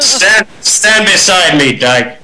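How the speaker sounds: slow, deliberate officer's command; captain's order. Retold or imitated. Captain placing the new lieutenant, slow stern command. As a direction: spell it out slowly to the green recruit.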